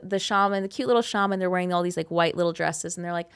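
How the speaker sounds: ordinary talk, nothing marked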